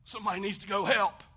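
high, loud mock alarm; hammed-up panicked shout; a churchgoer's loud mock panic. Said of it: "It's easy to be like".

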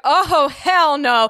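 loud, slow, high "hell no"; alarmed refusal. Retold or imitated. Frozen girl answering the whispering ghost, loud alarmed refusal. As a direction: draw it out in loud alarmed disbelief. Pinned down, hearing her name.

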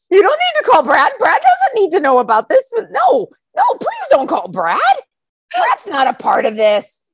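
high, urgent mock protest, easing at the end; hammed-up panic, pitch way up; panicked plea. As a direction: beg him frantically to drop it. Panicked plea against calling attorney.

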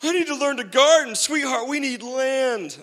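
high, loud hammed-up plea; mock panic. Acts out doomsday plea to sweetheart.

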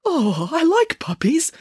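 high, slow, sobby newsreader; mildly acted weepy gushing; tearful murmur. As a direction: coo it slowly through mock tears. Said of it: "murmured the newsreader between sobs".

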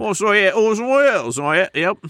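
high, garbled mock Cockney; Cockney accent. Friend teased about the accent keeps going, garbled Cockney. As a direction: spiral into high Cockney nonsense. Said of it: "You're spiraling into Cockney accent land".

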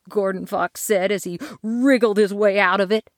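ordinary delivery

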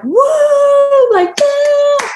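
high whooping cheers; crowd cheering. Retold or imitated. Bystanders cheering a finishing runner, excited.

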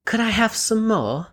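pleading orphan, rising in pitch; Oliver. Acted. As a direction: beg slowly with a high, hopeful lift. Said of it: "imagine I'm holding a bowl".

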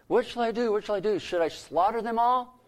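eager king. Retold or imitated. Eager king asks Elisha.

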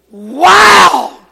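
loud, high amazed wow. Retold or imitated. Pastor seeing his church for the first time, amazed. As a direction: let the amazement burst out.

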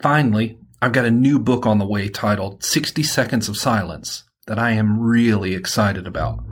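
ordinary delivery

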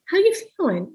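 casual open question. Shown as a demonstration. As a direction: toss it off casually, like you're just chatting. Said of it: "You kind of ask it a little open".

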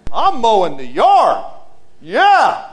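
put-on child shouting proudly while mowing; excited kid. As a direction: crow it like a proud five-year-old. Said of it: "you're going".

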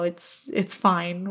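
ordinary talk

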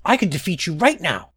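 high, small challenger's voice; insistent butterfly. A narrator's reading. Small butterfly challenges the fawn lord, high and insistent. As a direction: challenge a giant in a little high voice. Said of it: "Butterfly insisted".

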